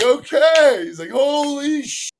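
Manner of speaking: high loud holy-cow reaction; amazed. Retold or imitated. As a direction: blown away by the lift. Trainer amazed, clapping hands.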